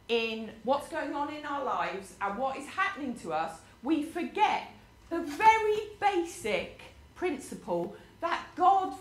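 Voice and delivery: normal talking voice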